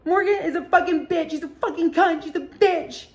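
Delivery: put-on high-pitched drunken tirade; shrieky mock outrage; mock furious rant. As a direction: imitate a friend ranting insults down the phone. Friend rants about her on phone.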